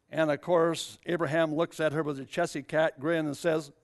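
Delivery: ordinary talk, nothing marked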